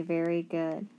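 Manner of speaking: normal talking voice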